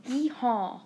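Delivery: lightly put-on Southern twang; mock Southern accent. Imagined smoothie order as Northerners picture it, mock Southern accent. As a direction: tack on a token cowboy cheer in a Southern accent. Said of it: "people would think that I say".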